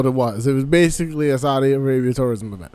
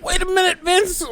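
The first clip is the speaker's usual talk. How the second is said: much higher voice, a mock Southern wrestler; imitating Michael Hayes. Cohost impersonates Michael Hayes talking to Vince, in a much higher mock voice. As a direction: squeak it out like a cartoon Southerner. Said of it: "Make your case, Michael P S Hayes".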